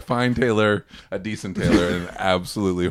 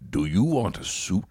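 lightly put-on gruff tailor asking "Do you want a suit?"; a hint of the smoking man voice as the tailor; mock shopkeeper voice offering a suit; tailor impression. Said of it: "sounds a lot like your smoking man impression".

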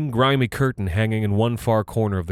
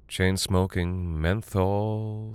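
fading close; 6 dB quieter, much slower than usual. A narrator's reading. Closing fade of the story.

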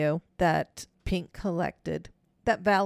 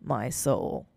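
low, eerie voice imitating a recording; different voice; 6 semitones lower than usual. Replaying the voice that follows Allison's promise, a different voice. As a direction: drop low for the ghostly interjection. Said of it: "which is not her voice".

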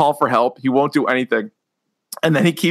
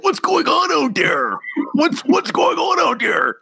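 mock alarmed; 9 semitones higher than usual. Same sketch quote again.

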